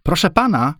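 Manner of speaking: high-pitched call for attention. A narrator's reading. Girl calling to a passing man, high call. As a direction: call across to someone, voice lifted high. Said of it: "zawołała w stronę Henryka".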